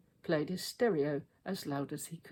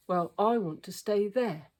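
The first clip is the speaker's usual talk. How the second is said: insistent Henry; 4 semitones higher, 7 dB louder than usual. A narrator's reading.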